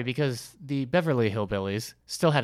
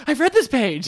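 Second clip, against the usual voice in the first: exasperated cry; 14 semitones higher, 6 dB louder than usual. Retold or imitated. Acting bored frustrated reader.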